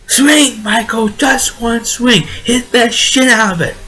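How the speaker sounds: high, drawn-out movie-line holler; imitated yell. Imitating Marsha Mason's character urging Michael to swing, high-pitched yell. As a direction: belt it out high, imitating a cheering mother. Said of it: "when she yells that".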